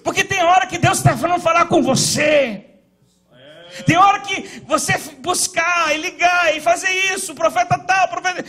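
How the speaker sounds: loud, climbing pulpit preaching; fervent sermon shout; preaching at full force. Acted. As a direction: preach it out to the congregation.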